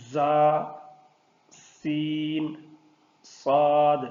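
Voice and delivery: زا، سین، صاد with a sharp hissing whistle; whistling letters. Shown as a demonstration. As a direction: let زا، سین، صاد whistle through the teeth. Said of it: "ان تین حروف میں سیٹی کی آواز". (سیٹی کی آواز کے ساتھ زا، سین، صاد)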